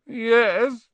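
high, whimpered agreement; miserable. Acted. Boy answering Harry about his lost toad, miserable. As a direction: forlorn boy, unconvinced by comfort. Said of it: "said the boy miserably".

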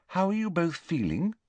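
high but held back, careful; tentative. A narrator's reading. Hermione asking the boys after staying away, tentative. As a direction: test the waters gently. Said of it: "she asked tentatively".

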